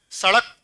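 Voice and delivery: सड़क with a quick retroflex flap ṛ; सड़क with ड़ flapped, never a hard ḍ. Shown as a demonstration. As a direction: flick the tongue out in सड़क, "sa-ṛak". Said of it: "उच्चारण में फर्क आया".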